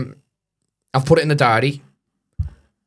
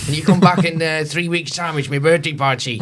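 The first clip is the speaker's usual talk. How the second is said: put-on higher, eager Hull accent; local Hull accent; 6 semitones higher, 4 dB louder than usual. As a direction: gush like a Hull pub regular, in his accent. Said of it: "What's the accent?".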